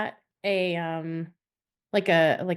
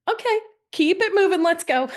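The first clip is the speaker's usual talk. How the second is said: high, laughing mock push along; much higher teasing imitation of the boyfriend; hurrying boyfriend; 11 semitones higher, 4 dB louder than usual. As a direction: hustle her down the line while laughing. Boyfriend voiced hurrying her on.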